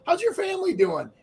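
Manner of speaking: high sing-song small-town hello; friendly greeting. Retold or imitated. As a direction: ask it like a cheerful neighbor.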